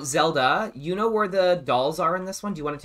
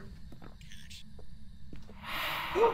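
long, high rising gasp; sharp fright, real gasp; startled gasp; 12 dB quieter than usual. Real, not acted. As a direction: suck in a frightened breath. Startled by ghost in ear.

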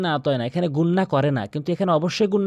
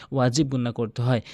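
normal talking voice

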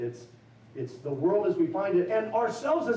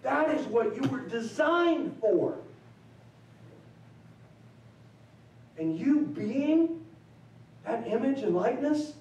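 higher, slow, building preacher cadence; rising sermon climax; 7 semitones higher, slower than usual. Acted. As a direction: preach it out with swelling conviction.

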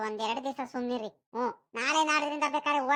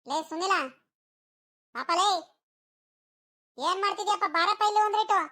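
put-on raised voice calling a boy over from a distance; 4 semitones higher than usual. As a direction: call him to come over, voice pitched up. Calling Sunil from afar.

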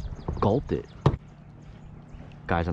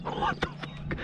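real breathy disbelief; 6 dB quieter, slower than usual. Real, not acted. Disbelief after lost fish.

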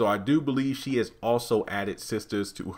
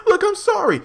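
very high, loud squeaky apology; squeaky pleading; 8 dB louder than usual. Retold or imitated. Andy begging Jasmine, squeaky and scared.

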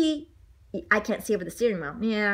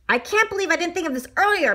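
mildly out of breath, pitched up; a touch of acted panting; 6 semitones higher, faster than usual. A narrator's reading. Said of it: "Mister Bean panted".

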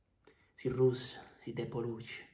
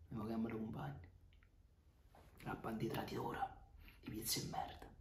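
quiet contempt; 14 dB quieter than usual. Acted. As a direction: spit it out barely aloud. Contempt for traitor companions. (disprezzo sottovoce)

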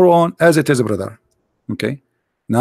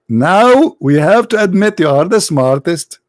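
usual speaking voice

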